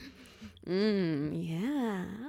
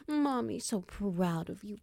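usual speaking voice

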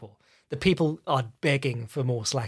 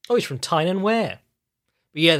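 normal talking voice

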